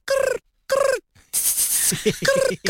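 mock snores, rough and rhythmic; imitated snoring. Wife imitating husband asleep in tent, mock snoring. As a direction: imitate a sleeper snorting away. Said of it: "Snore and whatever".

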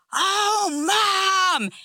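put-on high-pitched kids' cry of amazement; mock kid squeal, stretched out; shocked kids. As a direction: imitate astonished children crying out to their mother. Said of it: "And they're like".

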